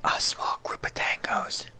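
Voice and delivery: slow, hushed whisper. Real, not acted. One player to his teammate about enemies nearby, whispering. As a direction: whisper it low and drawn out. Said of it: "But why are we whispering?".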